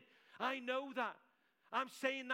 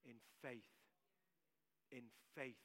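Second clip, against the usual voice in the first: soft emphasis; 11 semitones lower, 15 dB quieter than usual. Real, not acted.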